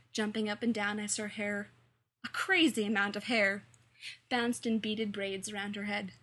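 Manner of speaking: ordinary delivery, nothing marked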